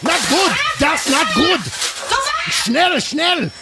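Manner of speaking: high-pitched, shrieky mock German accent; crazy German kid. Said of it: "it's that crazy German kid".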